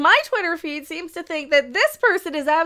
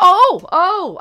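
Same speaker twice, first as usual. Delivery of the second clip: excited; 5 semitones higher, 8 dB louder than usual. Real, not acted. Sudden excited idea.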